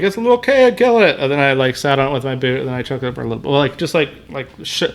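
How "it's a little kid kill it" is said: higher-pitched mock voice of his buddy; imitating buddy. Buddy urging him to finish off the creature, higher mock voice. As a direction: lightly mimic the buddy, pitched up. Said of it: "And my buddy was like".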